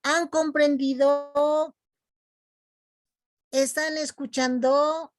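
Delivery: high, drawn-out questions to a class; teacher checking understanding. Acted. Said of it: "Yo cada vez les pregunto".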